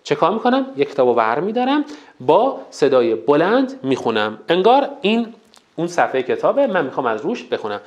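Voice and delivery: ordinary talk, nothing marked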